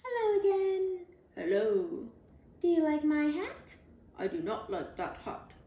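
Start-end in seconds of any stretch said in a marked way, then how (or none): 0.0-1.0 high and louder, sing-song greeting; the lady dog calls out hello. Acted. Hat dog greets again. 2.6-3.7 higher, hopeful questioning voice; the lady dog shows off her hat. Acted. Hat dog asks again.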